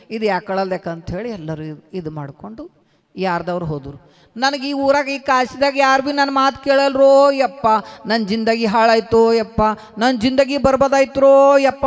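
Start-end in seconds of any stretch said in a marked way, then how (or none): 4.4-9.6 high, loud wailing cry of despair; wailing lament. Acted. Kabir laments to the village, loud wailing. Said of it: "ಇದ್ರಕಿನ್ನ ಜೋರು ಅಳಕ್ ಶುರು ಮಾಡ್ದನು". 10.0-11.8 high, loud wailing cry of despair; wailing lament. Acted. Kabir laments to the village, loud wailing. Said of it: "ಇದ್ರಕಿನ್ನ ಜೋರು ಅಳಕ್ ಶುರು ಮಾಡ್ದನು".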